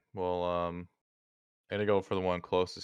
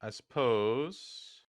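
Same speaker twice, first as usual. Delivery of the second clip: ordinary talk, nothing marked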